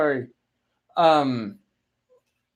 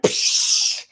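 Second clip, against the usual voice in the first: dismissive psh. Retold or imitated. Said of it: "make a joke off of it".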